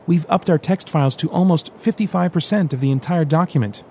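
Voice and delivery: a lightly excited report. Acted. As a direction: proudly share good news. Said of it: "Rand said excitedly".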